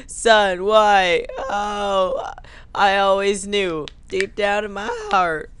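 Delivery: high, hammed-up tearful lament; mock grief. Father mourning his son, hammed-up grief. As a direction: lament as if sobbing over a loss.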